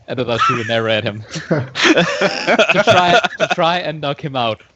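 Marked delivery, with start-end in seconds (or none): none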